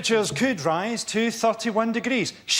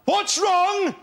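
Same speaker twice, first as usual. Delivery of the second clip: exasperated Picard; 10 semitones higher than usual. Acted. Picard repeats the crew's question in high indignant outrage. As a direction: fling the question back in outrage, pitched way up.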